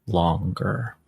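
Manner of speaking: ordinary delivery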